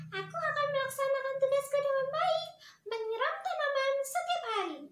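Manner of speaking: put-on high, loud child asking her father; small daughter asking her dad, high and bright; little girl voice. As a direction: pipe up like an eager little girl.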